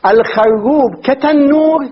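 slow "الخروب كتنور", a vowel first and doubled middle consonants; modelled on tannur. Shown as a demonstration. Teacher gives the word's model, "الخروب كتنور" slow with doubled r and n. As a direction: spell out the pattern slowly in "الخروب كتنور", xarrub like tannur. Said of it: "كيف التاء مفتوحة".

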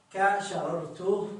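كشررت said slowly with the doubled راء held; drawn-out example word. Shown as a demonstration. Teacher reading the example كشررت slowly to students, drawn out. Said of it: "قال شررت".

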